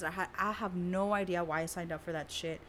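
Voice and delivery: normal talking voice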